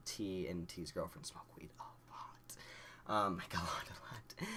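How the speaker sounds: unvoiced confidential whisper. Real, not acted. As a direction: whisper it like a secret. Whispering about friends' habit.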